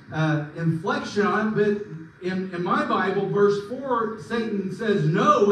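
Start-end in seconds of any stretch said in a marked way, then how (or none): 5.1-5.5 loud, higher emphatic "No"; adamant no. Retold or imitated. Satan flatly refusing God's absolutes with an emphatic "No". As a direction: slam down a flat refusal. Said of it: "explanation point".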